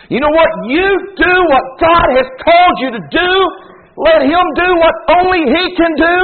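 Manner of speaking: ordinary talk, nothing marked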